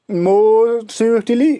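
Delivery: slow drawn-out demo. Shown as a demonstration. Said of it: "ये आपको याद रखना है". ("more swiftly" को बहुत धीरे खींचकर, "swiftly" पर आवाज़ ऊपर उठाते हुए)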